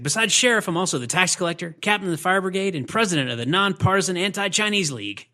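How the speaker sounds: mock official announcing himself; politician sheriff impression. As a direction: reel off titles like a puffed-up politician. Acted pompous politician sheriff quote.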